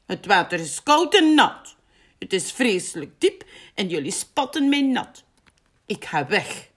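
mildly cross, emphatic complaint; angry. A narrator's reading. Big complains to his friends about the water, mildly angry. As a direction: list grievances crossly, then leave. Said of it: "zegt Big boos".

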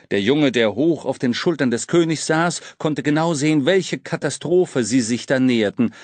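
ordinary delivery

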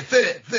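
ث با زبان میان دندان، با خنده; lisped s. Shown as a demonstration. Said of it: "زبونش اینجوریه". (ادای لکنت زبان در ث)

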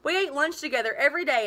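plain reading voice

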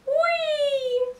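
high, sustained falling-object squeal; high drawn-out whee. A narrator's reading. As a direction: sail through the air on a long high note. Sound effect of falling ice cream.

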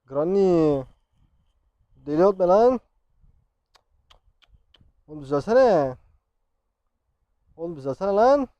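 very loud, fed-up shouting at the game; exasperated yelling. Real, not acted. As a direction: yell at the screen to fix itself. Screen will not recover, player yells. (çileden çıkmış bağırma)

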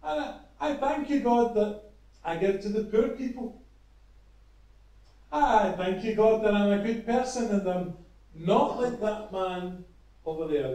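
hammed-up pompous Pharisee; proud loud prayer. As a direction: pray for everyone to hear, full of yourself. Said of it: "he says in a loud voice that everybody could hear".

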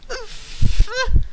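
high, loud crying shriek that cuts off; overdone outraged cry; mock anguished shriek. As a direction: break into a hammed-up wail.

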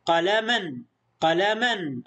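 قلما ending in a clear -an; stop on tanween. Shown as a demonstration. Teacher shows where to stop, fath tanween ending. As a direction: stop قلما on the n, no long final a. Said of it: "ونتوقف دائمًا عند التنوين فنقول".

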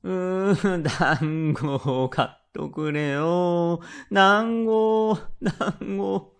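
high, slow, drawn-out mock wail; hammed-up childish tantrum; bawling child. A narrator's reading. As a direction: cry for a treat like a small boy, dragging out the vowels. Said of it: "と大声で泣き出し".

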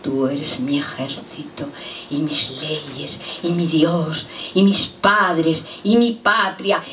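poem refrain, slow and measured; unhurried litany that rises on the last item; slow recited litany. Acted. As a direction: recite it item by item, drawing each out. Refrain built slowly item by item.

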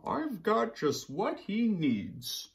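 high, slow sleepy panda. Acted. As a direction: read it as a drowsy panda. Panda's voice in the story.

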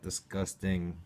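ordinary talk, nothing marked

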